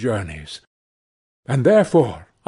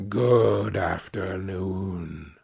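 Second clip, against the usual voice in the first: lower, softer and very slow; cold quiet dismissal; 6 semitones lower, 6 dB quieter, much slower than usual. Acted. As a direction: let it drop, final and cold. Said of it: "said Scrooge".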